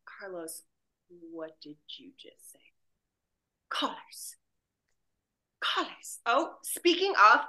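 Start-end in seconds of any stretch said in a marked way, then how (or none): 0.0-2.6 soft, low spoken Carlos line; quiet. Shown as a demonstration. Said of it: "a Carlos quiet one". 3.7-6.2 breathy whispered Carlos. Shown as a demonstration. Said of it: "a Carlos quiet one".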